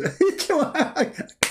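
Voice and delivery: high drawn-out giggle; giggle, delighted. Pretend. God voice giggling.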